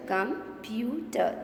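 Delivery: "computer" slowly as com, pu, ter; drawn-out teaching split of "computer"; split into syllables. Shown as a demonstration. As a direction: say "computer" in three separate beats. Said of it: "Three sounds".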